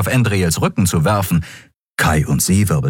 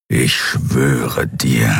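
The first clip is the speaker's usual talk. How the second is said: knurrend; 9 semitones lower, much slower than usual. Acted. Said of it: "knurrte der Skria". (der Skria knurrt den Drogenhändler tief und langsam an)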